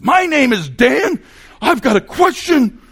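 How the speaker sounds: high, loud, amped-up shouting; ticked-off heckler. Retold or imitated. Angry student thrusting his hand up at Q and A, ticked-off shout. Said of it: "he was ticked".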